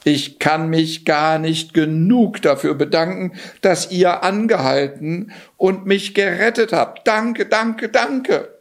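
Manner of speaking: high voice, climbing with each thanks; effusive gratitude. A narrator's reading. Rescued Johann thanking the king, effusive.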